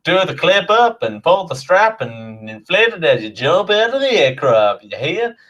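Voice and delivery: hammed-up Southern US air hostess safety briefing; a mock Southern US flight attendant walking through safety; Southern US accent. Air hostess briefs passengers in a hammed-up Southern US accent. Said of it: "could you imagine me going".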